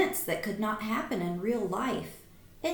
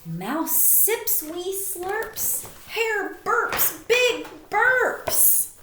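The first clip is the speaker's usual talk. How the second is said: slow, much higher, louder on the burps; playful storybook read; 9 semitones higher, 5 dB louder, much slower than usual. A narrator's reading. Teacher reading the burping scene to the class, hammed up and slow. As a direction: ham up the burps for the kids. Reads picture book aloud to children.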